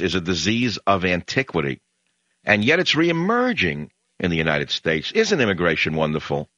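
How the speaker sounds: ordinary talk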